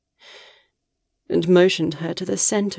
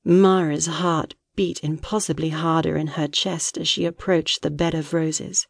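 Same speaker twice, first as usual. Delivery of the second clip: ordinary delivery, nothing marked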